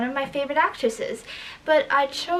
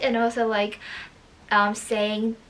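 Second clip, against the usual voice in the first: ordinary talk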